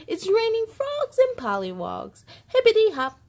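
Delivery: high, bright burst of delight; excited. Acted. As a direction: squeal it like a kid seeing frogs. Excited book line.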